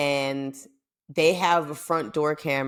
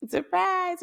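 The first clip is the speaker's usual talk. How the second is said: high, drawn-out squeal; surprise announcement. Retold or imitated. Acting out surprising her aunt.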